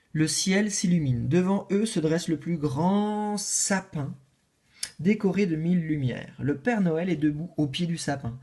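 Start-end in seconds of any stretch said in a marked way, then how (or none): none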